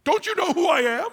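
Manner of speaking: snubbed donkey insulted nobody knows him; indignant donkey. Acted. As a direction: insist on your importance, up high. Donkey demands recognition.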